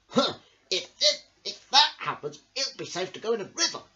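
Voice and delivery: high, fast, chuckling pig voice; grunting pig. Acted. Wild pig hoping to swim again, grunting. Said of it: "grunted a wild pig".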